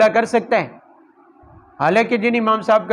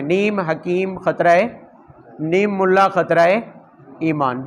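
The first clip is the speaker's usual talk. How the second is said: slow proverb; slower than usual. Shown as a demonstration. Quoting proverb slowly for emphasis.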